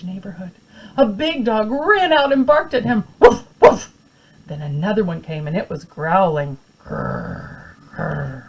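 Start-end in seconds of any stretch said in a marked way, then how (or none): 3.2-3.9 high, loud woof woof; dog barking. Acted. Big dog barking at the boy, loud bark. 6.8-8.4 low breathy growl; dog growl. Acted. Second dog growling at the boy, low growl.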